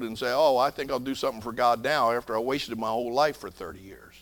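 normal talking voice

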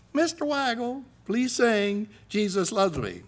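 a hint of a gushing teacher; music teacher voice. Teacher pleading.

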